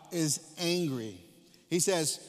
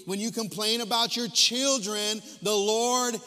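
louder, slowing hard on each the Lord hears you; preaching at full force; 6 semitones higher, 6 dB louder, slower than usual. Acted. Preacher thundering at the congregation about complaining, louder and slow. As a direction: build the refrain, hammer every line.

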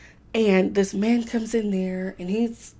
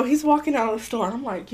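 ordinary talk, nothing marked

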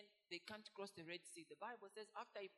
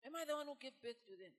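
louder, higher pleading repeat; crying out to God; 5 semitones higher, 6 dB louder than usual. Acted. Moses repeating his complaint to God, pleading. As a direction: press the question again, pleading. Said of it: "Moses was crying out to God".

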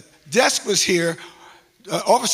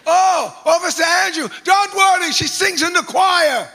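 loud, much higher Jamaican greeting, slow at the start; Jamaican accent greeting; 12 semitones higher, 9 dB louder than usual. Retold or imitated. The officer greets the pastor loudly in a Jamaican accent. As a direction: hail a friend warmly in a Jamaican accent. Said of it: "in this strong Jamaican accent".

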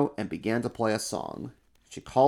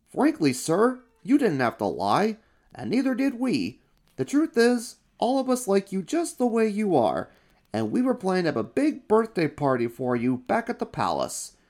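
eager helper; 10 semitones higher than usual. Acted. Royal helper urges the crowd to cheer the fur. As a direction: whip up the crowd, over-keen.

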